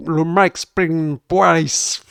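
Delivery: drawn-out attempt at an English accent. Real, not acted. Streamer showing off to his viewers, exaggerated English accent. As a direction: say it slowly in a French speaker's showy English accent. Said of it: "mon accent anglais qui commence à déchirer".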